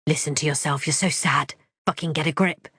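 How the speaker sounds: ordinary delivery, nothing marked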